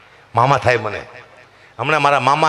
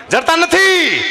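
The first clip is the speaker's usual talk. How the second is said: frustrated shout; 12 semitones higher, much slower than usual. Real, not acted. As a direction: burst out, exasperated. Repeat shouted for emphasis.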